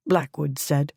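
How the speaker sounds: ordinary delivery, nothing marked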